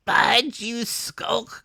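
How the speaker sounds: high, loud scornful snarl; crying out. Acted. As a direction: sneer at the coward. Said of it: "cried Pew".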